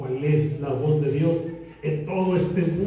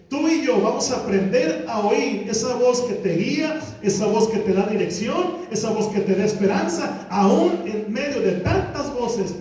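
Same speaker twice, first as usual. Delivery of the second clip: higher and louder, rising preacher's build; fervent pulpit proclamation; preaching at full force; 5 semitones higher, 3 dB louder than usual. Acted. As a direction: preach it out to the congregation. Sermon climax, voice raised.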